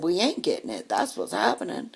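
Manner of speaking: imitated Mississippi local drawl; emphatic Southern twang, retold. Local answering about finding food, imitated Southern drawl. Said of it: "Southern drawl".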